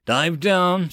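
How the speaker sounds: urgent shout to dive; yelled command. A narrator's reading. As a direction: bark it over the roar of wings. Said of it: "Stanford yelled".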